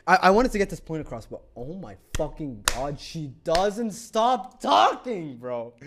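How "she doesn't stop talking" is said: DOESN'T STOP TALKING drawn out loud and high; loud, very slow, rising exasperation on "doesn't stop talking"; exasperated. Real, not acted. Exasperated at her endless talking.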